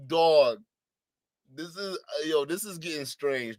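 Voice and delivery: ordinary talk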